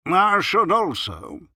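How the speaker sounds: very slow, high, loud and pompous; very wise tone. A narrator's reading. Doctor repeating himself to the landlady, very wise tone. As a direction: intone it as if dispensing great wisdom. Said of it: "said the doctor in a very wise tone of voice".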